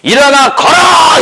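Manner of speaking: high, drawn-out shout; loud command. Retold or imitated. Paul ordering the man who never walked, shouted. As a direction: belt out the order, stretching the end. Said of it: "큰 소리로 말합니다".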